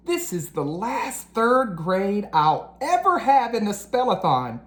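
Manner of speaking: lively, expressive storybook reading; animated children's book narration. A narrator's reading. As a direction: read it like bedtime story time for kids.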